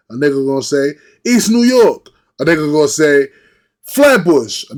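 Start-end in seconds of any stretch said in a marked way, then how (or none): 1.2-2.1 high, loud imitated answer; emphatic shout-out. Acting out how locals name their part of Brooklyn, emphatic shout-out. 3.8-4.6 raised, louder imitated answer; emphatic shout-out. Acting out a local naming their Brooklyn area, emphatic shout-out.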